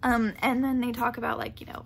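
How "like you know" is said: ordinary talk, nothing marked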